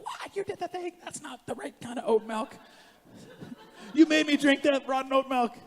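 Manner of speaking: high-pitched, hammed-up outrage; mock angry. Boss scolding staff over rotten oat milk, mock outrage. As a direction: build from disbelief to a loud, squawking mock tirade. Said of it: "I'm not, like, angry, you know, with my staff".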